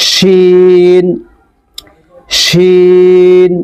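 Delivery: sustained, resonant শিন; long shin like tালব্য স. Shown as a demonstration. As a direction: stretch শিন slowly. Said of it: "এই শিনের উচ্চারণ তেমন".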